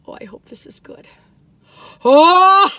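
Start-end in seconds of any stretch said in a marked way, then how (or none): 2.0-2.8 loud, high "oh" into long laughter; delighted gasp-laugh. Real, not acted. Sees inside of the cookie.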